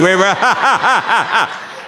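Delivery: high, rolling belly laugh; big laugh. Real, not acted. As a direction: burst into a long ha-ha-ha. Laughs at his relieved punchline.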